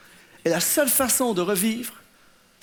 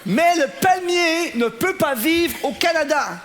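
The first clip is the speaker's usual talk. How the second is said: laughing emphatic line; 5 semitones higher, 3 dB louder, slower than usual. Real, not acted. Punchline about the palm tree.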